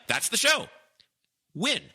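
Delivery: that's the show thrown up high and loud with a rising end; delighted exclamation; excited. Real, not acted. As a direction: cry that's the show as a thrilled discovery. Host marvels at winning idea.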